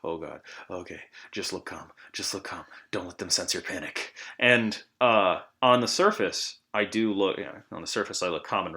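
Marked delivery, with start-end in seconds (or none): 0.0-4.1 soft nervous self-talk under breath; muttering to self. Retold or imitated. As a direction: talk yourself down quietly. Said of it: "I was like".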